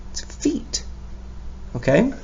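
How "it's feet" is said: fully whispered "It's feet", much softer. Shown as a demonstration. Teacher whispers "feet" back to the kids, whispered. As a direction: breathe "It's feet" out as a secret.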